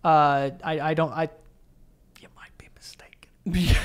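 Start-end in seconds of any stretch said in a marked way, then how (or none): 2.2-3.8 under his breath. Real, not acted. Gently contradicting co-host under breath.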